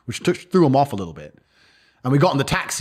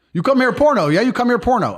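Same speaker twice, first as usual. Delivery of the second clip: much higher, louder taunting thug voice; mocking Moldovan guy; 11 semitones higher, 3 dB louder than usual. Moldovan man provoking the brothers before the attack, high taunting jeer. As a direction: jeer it at the foreigners, pushy. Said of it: "He goes".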